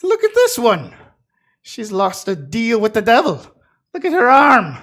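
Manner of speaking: high, loud scornful jab; sneering. Acted. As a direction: point and sneer. NPC mocks loudly.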